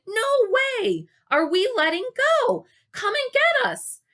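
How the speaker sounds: very high, loud protest; shouting. Acted. Gary begging Dale to come get them, loud shout. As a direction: yell it in panic. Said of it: "Gary shouted".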